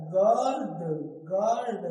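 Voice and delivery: गार्ड said emphatically as "gaard"; careful गार्ड with no w glide; silent u. Shown as a demonstration. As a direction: punch out गार्ड, g straight into long aa. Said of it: "तो यू क्या है?".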